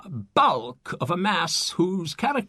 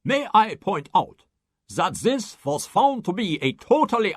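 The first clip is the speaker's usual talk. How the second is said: a fussy German professor correcting everyone; indignant German scientist; 3 semitones higher than usual. Acted. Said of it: "The German scientist's rejoinder".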